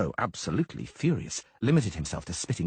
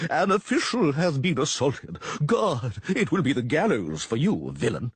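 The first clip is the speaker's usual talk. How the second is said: outraged official; 5 semitones higher, 4 dB louder, slower than usual. A narrator's reading. Official shouting threats.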